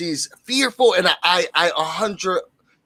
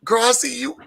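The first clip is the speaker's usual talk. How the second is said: laughing; 7 semitones higher than usual. Retold or imitated. Laughing while quoting herself.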